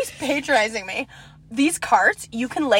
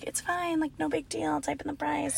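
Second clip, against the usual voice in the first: much softer, higher, easygoing reassurance; soft polite reassurance; 4 semitones higher, 14 dB quieter than usual. Retold or imitated. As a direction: ease her embarrassment gently. Politely reassuring the embarrassed cashier.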